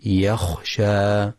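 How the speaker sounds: يخشى with a crisp, fully voiceless kha, very slow; clear kha. Shown as a demonstration. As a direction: articulate the kha of يخشى distinctly. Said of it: "والصواب تحقيق الخاء".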